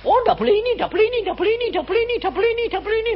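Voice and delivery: high, prissy sing-song repeating no; mock legalist. Preacher mimicking believers who turn faith into rules, high mocking chant. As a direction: parody a fussy rule-keeper.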